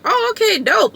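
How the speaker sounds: excited. Retold or imitated. Retold excited reaction.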